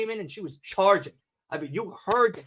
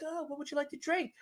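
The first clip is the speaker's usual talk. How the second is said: high, soft mock flight attendant sweetness; stewardess voice; 7 semitones higher, 13 dB quieter than usual. Stewardess offering drinks, high and soft. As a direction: put on a sugary cabin-crew voice. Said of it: "the two s-, stewardesses on the plane are like".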